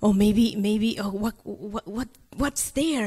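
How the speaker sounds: low, hushed, stammering worry; halting, softly muttered worst-case thinking; worried inner voice. Retold or imitated. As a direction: replay anxious thoughts under your breath. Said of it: "I start thinking the worst".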